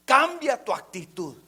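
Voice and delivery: high, loud commanding voice; high, loud rebuke; God's command. Acted. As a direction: boom it as God's order.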